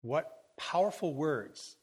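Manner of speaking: ordinary talk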